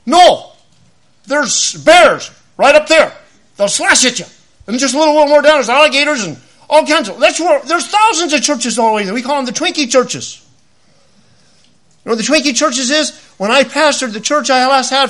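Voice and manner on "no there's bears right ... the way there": loud preaching, high and forceful; shouted warning. Real, not acted. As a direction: warn them loudly of danger.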